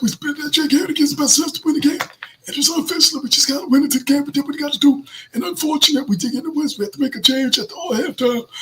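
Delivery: high raspy coach impression; Rizzy voice impression. Host imitating the head coach at a press conference, high and raspy. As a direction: play the coach at the podium, raspy. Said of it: "the rattler voice that I do".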